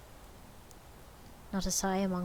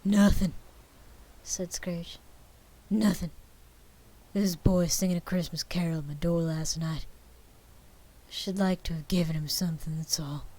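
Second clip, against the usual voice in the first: wistful Scrooge; 5 dB louder than usual. A narrator's reading.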